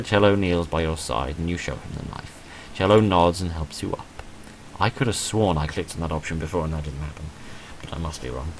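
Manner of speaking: ordinary delivery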